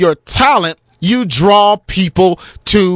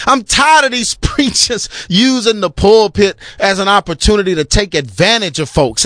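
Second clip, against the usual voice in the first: ordinary talk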